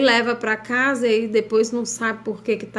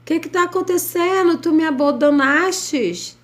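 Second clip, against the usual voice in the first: high anguished cry to God; desperate plea to God; 8 semitones higher than usual. Retold or imitated. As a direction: plead like someone who feels abandoned. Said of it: "parece assim".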